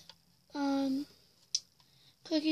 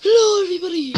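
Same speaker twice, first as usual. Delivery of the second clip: loud greeting; 4 semitones higher, 14 dB louder, faster than usual. Real, not acted.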